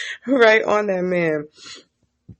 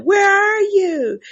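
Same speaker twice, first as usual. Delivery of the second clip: mock yell; slower than usual. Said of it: "Daemon is on the dragon yelling".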